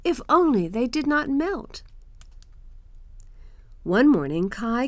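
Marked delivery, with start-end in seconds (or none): 0.0-1.8 put-on high-pitched boy showing a marvel; enthusing boy. Kai shows Gerda snowflakes through glass, enthusing boy. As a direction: voice an eager boy, pitched well up.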